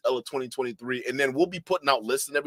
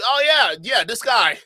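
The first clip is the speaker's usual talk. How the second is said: gullible believer; 8 semitones higher, 6 dB louder, slower than usual. Pretend. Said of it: "Put a one if you believe".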